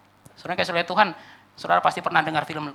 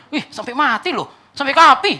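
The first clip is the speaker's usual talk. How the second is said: raised pitch, swelling louder at the end; mock awe; 7 semitones higher, 7 dB louder than usual. Onlookers amazed by a wife's devotion until death, mock awe. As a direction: marvel with exaggerated amazement. Onlookers gush over the widow.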